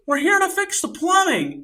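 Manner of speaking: retold shout from a stranger outside; calling through a door. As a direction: call it out high to be heard through a door. Shouted answer through the closed door.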